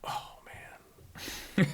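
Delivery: retold hushed groan of dismay; soft, breath-only letdown; whispered dismay. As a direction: whisper it, let down. Sneeze spoils the stalk.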